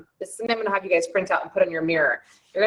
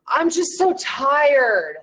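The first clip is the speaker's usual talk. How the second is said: slow tired sigh; 6 semitones higher, 3 dB louder, much slower than usual. Shown as a demonstration. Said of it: "if you say".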